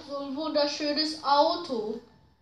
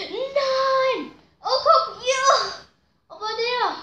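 excited shout; 9 semitones higher, 7 dB louder, slower than usual. Real, not acted. Sudden turn in the race.

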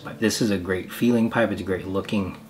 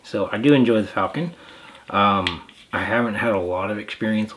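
talking around pipe stem; speaking with clenched teeth; pipe in mouth; 4 dB louder than usual. Real, not acted. Seen 3: Pipe clenched in teeth while speaking; removed at 4.4.

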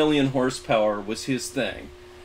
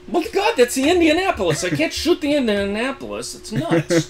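high, hammed-up disbelief; mock horrified exclamation; 13 semitones higher, 5 dB louder than usual. History buff refusing to shoot the Indianapolis in a match, mock-shocked high cry. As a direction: squeal it like you can't bear to fire on her. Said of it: "I said".

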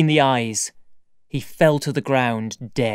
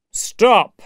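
higher-pitched firm command; strong commanding call; loud, strong voice; 7 semitones higher, 4 dB louder than usual. Acted. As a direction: call a halt with authority. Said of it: "in a loud, strong voice".

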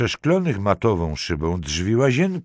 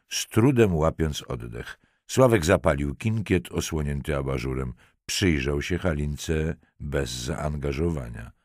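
usual speaking voice

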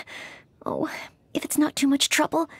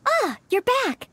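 usual speaking voice

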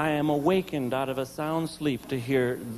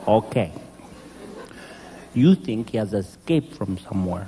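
mock patience; 6 semitones lower than usual. Husband answering his wife, lower, mock-patient and dry. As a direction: humour her with low exaggerated patience.

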